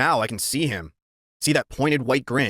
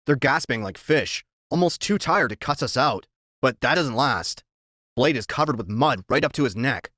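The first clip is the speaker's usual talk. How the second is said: ordinary delivery, nothing marked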